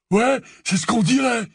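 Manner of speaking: high sneering voice; high sneering second Death Eater; sneering thug. A narrator's reading. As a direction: jeer at him. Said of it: "lança une deuxième voix".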